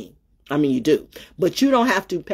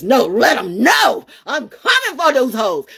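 fired-up daddy; 7 semitones higher, 6 dB louder than usual. Retold or imitated. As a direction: hype it up like a protective dad. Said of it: "Daddy says".

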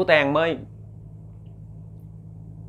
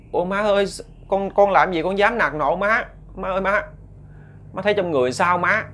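higher, pleading, worried son's voice; anxious son begging his mother to tell him what's wrong; 7 semitones higher than usual. Acted. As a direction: fuss over his mother with rising concern. Son denies scolding his mother.

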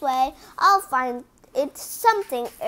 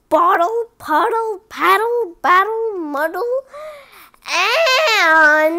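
louder, racing finish; triumphant loud finish; 6 semitones higher, 10 dB louder than usual. Real, not acted. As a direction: belt out the end. Climax of tongue twister.

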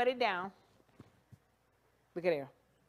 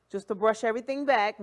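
normal talking voice

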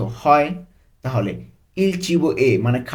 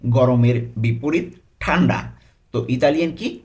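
ordinary delivery

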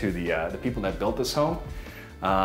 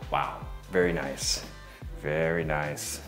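low, slow, quiet admiration; gushing real estate agent; 10 semitones lower, 8 dB quieter, slower than usual. Real, not acted. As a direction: savor it softly, slowly. Agent admiring the closet shelf.

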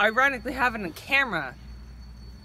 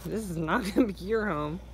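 ordinary talk, nothing marked

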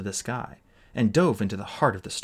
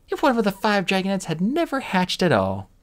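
high, loud taunt; high mocking threat; 10 semitones higher, 4 dB louder than usual. A narrator's reading. Queen threatening the egg, high and loud. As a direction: tease him with the danger.

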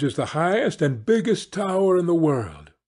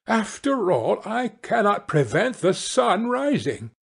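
higher, resentful complaint; indignant wife voice; 7 semitones higher than usual. A narrator's reading. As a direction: grumble at what she can't control. Wife frets at the sun.

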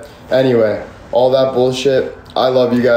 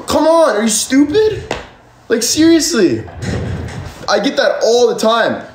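much higher, rising exasperation; heated rant; 7 semitones higher than usual. Real, not acted. Streamer ranting at viewers who call him greedy, much higher and exasperated. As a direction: vent at the doubters, voice climbing.

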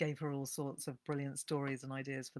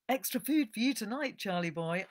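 higher and louder, fond call to the mule; Marjorie to her mule; 6 semitones higher, 6 dB louder than usual. Acted. Rider promising her mule extra food, fond and raised. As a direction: cheer the tired mule on.

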